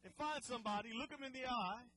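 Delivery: ordinary talk, nothing marked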